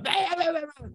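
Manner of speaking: high strangled yelp; Larry David scream. Retold or imitated. Imitating Larry David's scream, high and strangled. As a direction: squeal like Larry in the show. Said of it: "when he screams".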